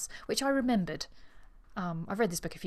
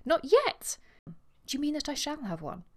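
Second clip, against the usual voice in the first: mock shriek; 9 semitones higher than usual. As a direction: shriek it in pretend horror. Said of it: "She gave a shriek".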